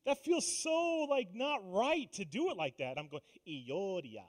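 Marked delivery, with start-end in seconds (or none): 3.5-4.2 mock Middle Eastern accent. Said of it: "this stupid accent".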